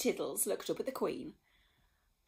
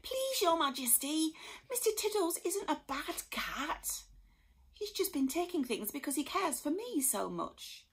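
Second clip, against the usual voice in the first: higher pleading voice; pleading boy; 8 semitones higher than usual. A narrator's reading. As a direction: beg the Queen to spare the cat. Harry pleads with the Queen.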